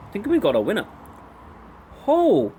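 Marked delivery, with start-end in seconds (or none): 2.1-2.5 surprised. Real, not acted. Reacting to a tasty snack.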